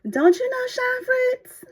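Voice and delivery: very high, drawn-out, slow disbelief; incredulous coworker. Retold or imitated. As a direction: stretch it out amazed she doesn't know. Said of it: "she said".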